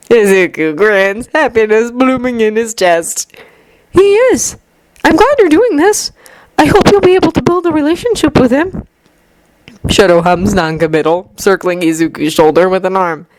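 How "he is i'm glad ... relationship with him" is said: put-on gentle young man voice. A narrator's reading. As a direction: voice Izuku lightly higher, kindly. Izuku voiced by narrator.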